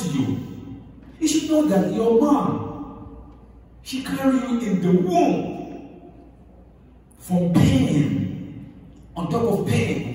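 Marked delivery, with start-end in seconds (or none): 1.2-10.1 high, slow pleading emphasis; impassioned appeal. Acted. Preacher urging the congregation about mothers, slow and pleading. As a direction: drag it out high and urgent.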